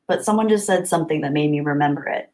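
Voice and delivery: usual speaking voice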